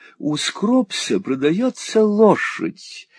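high, slow rapturous drawl; rhapsodize, stretching the temptation; foppish wheedling husband. A narrator's reading. Husband coveting a horse, high rapturous drawl.

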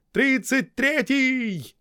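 high, slow call from far off; distant shouted call. A narrator's reading. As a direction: call it across the field. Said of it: "долетел издалека чей-то голос". (далёкий окрик)

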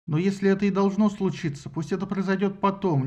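ordinary delivery, nothing marked

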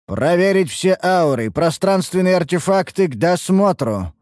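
high, forceful official command; growled order. A narrator's reading. Law officer to his squad at an inspection, growled command. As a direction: order a search like an irate lawman. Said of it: "рыкнул служитель правопорядка".

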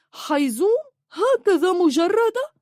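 very high-pitched scoffing disbelief; mock outrage. Ridiculing visitors for omitting a title, mock outrage. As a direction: repeat the name as if scandalised.

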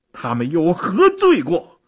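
loud, high rhetorical demand; acted, impassioned protest; indignant questioning. A narrator's reading. Nephew demands what their crime was.

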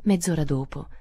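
plain reading voice

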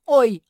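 high, very loud squeal; explosive pained shriek; shrieked yelp. A narrator's reading. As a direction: squeal in sudden pain. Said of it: "взвизгнул Ричард".

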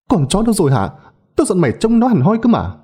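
high-pitched angry outburst; furious scolding. A narrator's reading. Slaps the girl, demands the dog.

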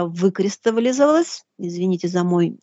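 ordinary delivery

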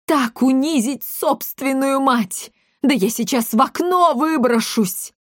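high-pitched wounded mother; melodramatic outrage. Acted. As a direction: threaten with theatrical despair.

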